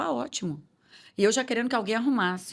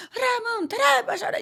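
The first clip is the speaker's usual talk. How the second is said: high-pitched burst of tongues; imitating tongues. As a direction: re-enact babbling in tongues. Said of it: "eu comecei a falar". (imitando o falar em línguas, bem agudo)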